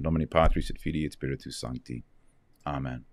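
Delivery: low, soft recited prayer; Latin prayer. Real, not acted. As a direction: pray it quietly and devoutly.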